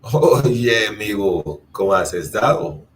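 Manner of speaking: put-on loud, lively call to an old friend; Oye, amigo called out loud; excited greeting. Shown as a demonstration. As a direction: greet a friend you haven't seen in ages. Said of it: "someone you haven't seen in a long time".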